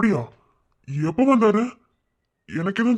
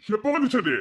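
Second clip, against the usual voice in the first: shocked cry; 7 semitones higher than usual. Acted. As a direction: blurt it out in shock. Shocked at news of arrival. (அதிர்ச்சியான கூச்சல்)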